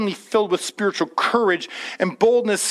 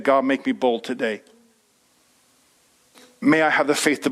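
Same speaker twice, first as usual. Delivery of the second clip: low, earnest prayer voice; 9 semitones lower than usual. Acted. Preacher modelling a morning prayer for listeners, low earnest prayer.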